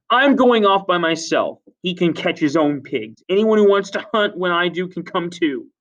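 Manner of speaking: high, loud declaration; angry defiance. Acted. Jack leaves and invites hunters, high loud defiance. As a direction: throw it at the whole group. Jack storms away from assembly.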